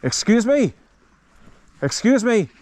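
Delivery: high, loud call across a distance; repeated polite shout for attention. Real, not acted. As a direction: get a stranger's attention from far off. Calling to a distant walker.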